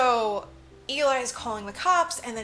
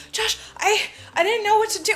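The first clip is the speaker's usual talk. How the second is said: high, loud, panicky mock confession; hammed-up distress, pitched way up; acted distraught plea; 11 semitones higher, 8 dB louder than usual. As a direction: play her frantic and overwrought. Retelling bloodied girl explaining herself.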